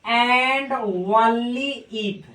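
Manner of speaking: very slow, only if drawn out; only if, said slowly; slow read-aloud. Shown as a demonstration. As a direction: spell it out so students hear each word. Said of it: "इसको पढ़ा जाता है".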